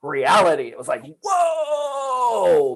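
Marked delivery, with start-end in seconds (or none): 1.2-2.8 ecstatic whoa. Retold or imitated. Reliving awakening moment.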